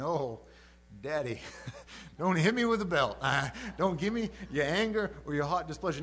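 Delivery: hammed-up little-kid plea, breaking into laughs; kid getting punished. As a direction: beg like a kid facing a spanking. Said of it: "[name] sounds like a kid getting punished".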